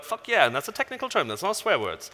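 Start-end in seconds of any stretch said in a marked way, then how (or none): 0.0-0.5 mock cheer. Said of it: "then you go like".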